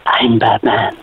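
low whispered Batman rasp. Acted. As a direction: breathe it like a brooding hero. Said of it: "have you got a Batman for us?".

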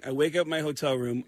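normal talking voice

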